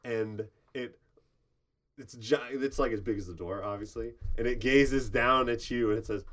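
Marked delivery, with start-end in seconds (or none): none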